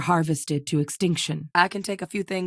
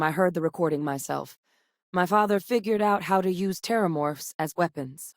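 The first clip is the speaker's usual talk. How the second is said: usual speaking voice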